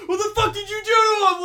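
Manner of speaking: high, loud angry yell; pissed-off outburst. Acted. Guy confronting whoever hurt his friend, pissed-off shout. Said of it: "gets pissed off".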